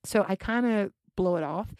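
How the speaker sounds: ordinary talk, nothing marked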